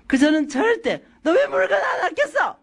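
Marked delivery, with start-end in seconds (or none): 1.2-2.6 high-pitched scolding question, laughing through it; mock scolding. Mother scolds son over a torn backpack, mock scolding. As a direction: play the nagging mom, half laughing. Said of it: "이게 아니라".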